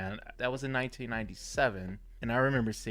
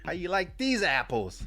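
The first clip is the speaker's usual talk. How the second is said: bad Boston accent; 8 semitones higher than usual. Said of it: "I can't do a Boston accent".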